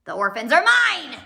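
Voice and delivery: insisting. Acted. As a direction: claim the children forcefully. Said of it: "he insisted".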